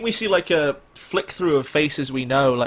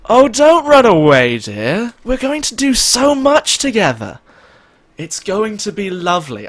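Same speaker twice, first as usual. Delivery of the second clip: high, loud, sweet menacing coo; eerie feminine voice; 8 semitones higher, 8 dB louder than usual. Acted. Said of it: "weird feminine echo".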